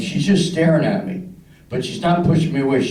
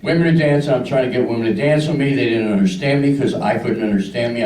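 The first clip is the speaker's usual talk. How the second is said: ordinary talk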